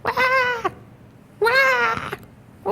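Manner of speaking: high wailing cry sweeping past; mock kid wail fading by; passing by doppler. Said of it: "Doppler effect".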